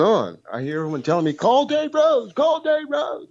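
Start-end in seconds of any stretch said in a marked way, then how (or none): 1.3-3.3 high, slow singsong of nagging voices; mocking chorus. Caller mimicking everyone nagging him to call, high and sing-song. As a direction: drag it out like a pestering crowd.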